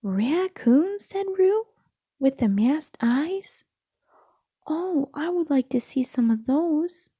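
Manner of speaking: high, loud, wide-eyed excitement; thrilled child. A narrator's reading. Roo thrilled to hear about raccoons, high and loud. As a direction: squeal with delight at the news.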